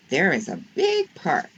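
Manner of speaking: ordinary delivery, nothing marked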